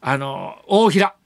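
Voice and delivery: halting imitation of a memory blank; groping for a name. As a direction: try out the name between long pauses. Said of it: "急にさ、出てこなかったんですよ". (名前が出てこず詰まる真似)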